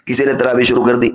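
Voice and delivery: ordinary talk, nothing marked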